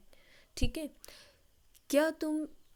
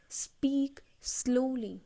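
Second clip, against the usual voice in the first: whispered model sentence; much slower than usual. Shown as a demonstration.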